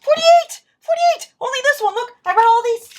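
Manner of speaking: high, loud excited squeals; giddy high-pitched bookworm glee. Real, not acted. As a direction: squeal with delight over the books. Excited squealing over book count.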